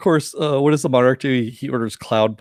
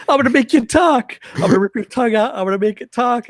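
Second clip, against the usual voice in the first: mock threat; 11 semitones higher than usual. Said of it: "Doctor Z busts in and says".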